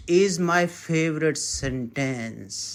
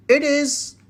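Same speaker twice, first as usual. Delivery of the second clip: normal talking voice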